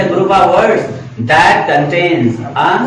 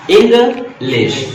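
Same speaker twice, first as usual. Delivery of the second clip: "English" slowly split in two, higher pitched; "English" in two spaced beats; two separate syllables; 5 semitones higher, much slower than usual. Shown as a demonstration. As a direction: break "English" into इंग, pause, लिश. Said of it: "दो syllable हैं".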